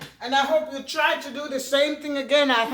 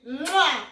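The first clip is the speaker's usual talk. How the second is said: blown kiss. Real, not acted. Blows kiss to subscribers.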